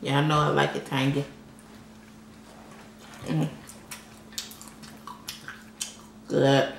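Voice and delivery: words muffled by chewing; talking while chewing. Real, not acted. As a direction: mouth partly full of food. Seen 1: bites shrimp at 1.0-2.7, chews through the rest.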